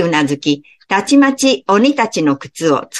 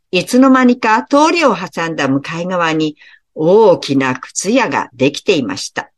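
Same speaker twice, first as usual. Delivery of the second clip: plain reading voice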